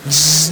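zap sound. Pretend.

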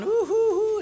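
high mock "ooh, hoo, hoo" of an admiring crowd; admiring crowd coos. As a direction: coo high like fans impressed by the preacher.

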